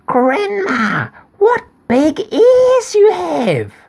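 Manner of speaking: very high, slow, loud wonder; girl amazed. Acted. Girl marvelling at grandma's ears, high and slow.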